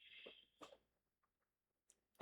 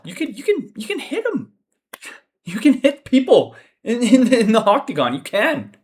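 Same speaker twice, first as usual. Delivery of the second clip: high, pleading disbelief; exasperated plea; 9 semitones higher, 4 dB louder than usual. Real, not acted. Fan pleading with a passive fighter to hit, high and exasperated. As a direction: beg him to just throw a punch.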